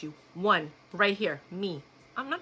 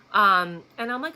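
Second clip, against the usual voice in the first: usual speaking voice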